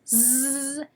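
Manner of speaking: "Z" as a long buzzing zzz; letter sound. Shown as a demonstration. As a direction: hold the sound of "Z", not its letter name. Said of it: "the S says Z".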